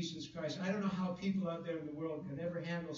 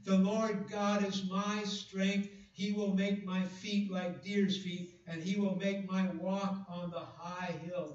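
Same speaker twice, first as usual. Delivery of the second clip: slow, raised reading of scripture; solemn preacher's scripture read; 2 semitones higher, 5 dB louder, slower than usual. Acted. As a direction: read it out slowly with weight.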